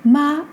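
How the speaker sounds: "Ma" held high and level; first tone. Shown as a demonstration. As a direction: sing out "Ma" on one steady high pitch. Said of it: "but have different tones".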